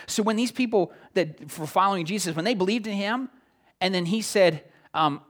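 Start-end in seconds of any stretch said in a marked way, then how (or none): none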